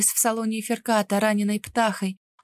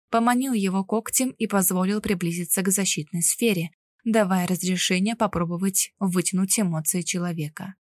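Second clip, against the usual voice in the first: usual speaking voice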